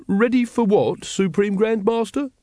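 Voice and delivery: a lightly voiced meek follower; high meek brother. A narrator's reading. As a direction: ask like a nervous junior member. Watchtower voiced in story.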